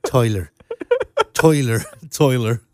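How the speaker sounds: thick Irish brogue on one word; southern Irish accent. Shown as a demonstration. Demonstrating how they talk down south, Irish accent. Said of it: "And then they say things like".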